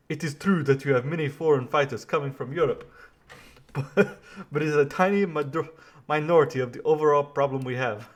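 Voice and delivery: put-on botched French accent. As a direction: fumble a French accent, half laughing.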